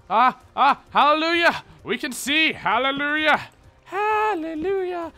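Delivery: joyful hallelujah. Pretend. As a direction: hail the light like a choir.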